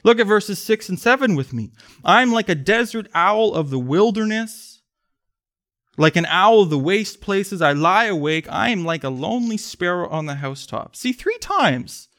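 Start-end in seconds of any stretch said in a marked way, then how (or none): 2.0-11.0 raised, earnest psalm reading; scripture reading. Acted. Pastor reading the psalm to the congregation, earnest. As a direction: read the images of loneliness with feeling. Reads psalm verses aloud.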